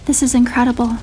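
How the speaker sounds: ordinary delivery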